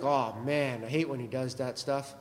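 low, muttered complaint of a listener; mock grumble. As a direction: grumble it under your breath, teasing the crowd. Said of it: "Some of you are like".

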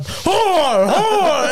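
high, drawn-out mock yell. Roommate yelling at pictures of women, high mock yell. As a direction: cry out each word in hammed-up outrage. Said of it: "he's just yelling at him".

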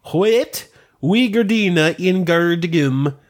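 hammed-up Old English recital, drawn out; backwards speech. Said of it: "you're doing the scene from Twin Peaks where the little dude's talking backwards".

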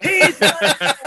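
yelling at the movie that the sidekick isn't a dick; protesting shout. Retold or imitated. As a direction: defend him at the screen. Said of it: "Arlene hears me go".

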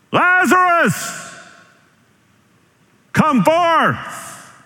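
high-pitched, drawn-out calling out; loud commanding cry. Acted. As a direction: holler it emphatically, as if to raise the dead. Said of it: "he cried out with a loud voice".

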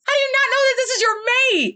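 can't believe how blind he is; exasperated disbelief. Real, not acted. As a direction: fan outraged at the oblivious hero. Exasperated at the oblivious character.

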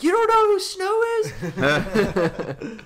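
high, loud disbelieving shout; mock outraged yell. Imagined listeners yelling at the hosts, mock outraged shout. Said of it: "they're probably yelling at me".

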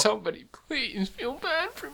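very high, soft mock whine; mock pleading. Host fishing for co-host's sympathy, mock pathetic plea.

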